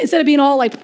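ordinary delivery

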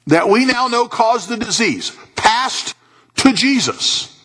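high, emphatic preacher delivery; impassioned preaching. Real, not acted. Preaches at full force.

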